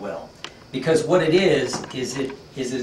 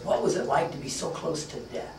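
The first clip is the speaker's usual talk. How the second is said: overcome with curiosity; 3 semitones higher, 5 dB quieter than usual. Acted. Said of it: "furrier is just overcome with curiosity".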